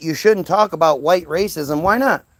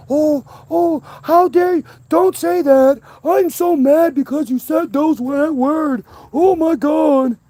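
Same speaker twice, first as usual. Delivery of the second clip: much higher, hammed-up pretend indignation; exaggerated fake offense, final line slow; mock outrage; 10 semitones higher, 4 dB louder than usual. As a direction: mimic a pearl-clutching victim, sarcastically. Mocking offended people's reaction.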